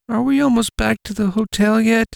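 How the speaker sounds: put-on high, small child calling her mother; little girl voice. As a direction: play a young girl tugging a sleeve. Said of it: "a little girl said".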